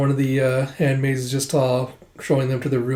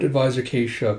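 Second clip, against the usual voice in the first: ordinary talk, nothing marked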